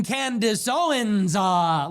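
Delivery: slow, drawn-out name, hanging on the pause; mocking Candace Owens. Host mocks Candace Owens's theatrical delivery, slow and drawn out. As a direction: drag it out like a grand entrance. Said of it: "it's always very theatrical".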